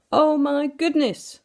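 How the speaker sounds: exclaiming. A narrator's reading. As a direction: gasp it out in dismay. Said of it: "exclaimed Mister Oldcastle".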